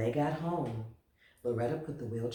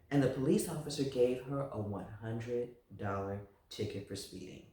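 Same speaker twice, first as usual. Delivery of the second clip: ordinary delivery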